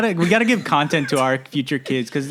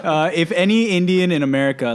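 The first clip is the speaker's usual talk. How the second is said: ordinary talk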